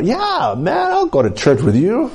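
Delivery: eager acceptance, pitch soaring; excited. Retold or imitated. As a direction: jump at the invitation in a high voice. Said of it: "I got excited in my heart".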